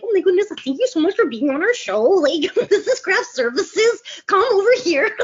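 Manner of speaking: high, loud gushing welcome with a laugh; bubbly set host. Retold or imitated. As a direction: welcome guest stars like a giddy host. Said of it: "I'm like".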